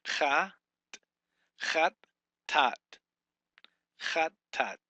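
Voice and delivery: Chat, Tat said as two separate loud beats; syllable by syllable. Shown as a demonstration. Teacher splitting a Hebrew word into its two syllables. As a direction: say Chat then Tat, apart. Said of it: "It's just two syllables".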